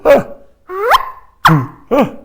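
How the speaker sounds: loud, high popped "uh" bursts; chuckle grunts. Shown as a demonstration. Teacher demonstrating the elk chuckle by mouth, loud popped grunts. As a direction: punch out short bull-chuckle grunts. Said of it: "and go".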